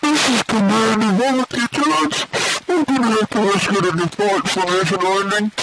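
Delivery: a kid playing a soldier giving radio orders; radio commander. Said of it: "you're giving radio commands".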